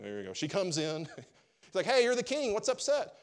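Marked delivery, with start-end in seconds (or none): 1.9-3.1 high, loud Jezebel voice; hammed-up nagging wife; pushy queen. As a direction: scold the king in a raised, high pitch. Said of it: "then comes his wife, Jezebel".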